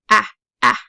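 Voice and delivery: loud open short ah, said twice; short a sound. Shown as a demonstration. Teacher sounding out the short A vowel, loudly. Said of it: "That is a short syllable".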